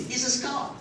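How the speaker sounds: ordinary delivery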